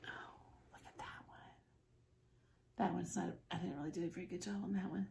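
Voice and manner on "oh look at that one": soft, quick whisper of admiration; hushed marvelling whisper; awed whisper. Real, not acted. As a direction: breathe it out in wonder. Admiring shimmer up close.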